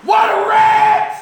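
high, loud mock wail; horrified outcry. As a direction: cry out in hammed-up horror at yourself. Acts horror at own reflection.

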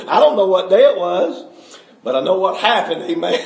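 usual speaking voice

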